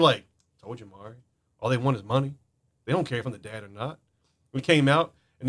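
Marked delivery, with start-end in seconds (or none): 0.6-4.0 soft, unbothered deadpan; retold calm. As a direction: shrug it off quietly, imitating a man who expected it. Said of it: "he was just as calm".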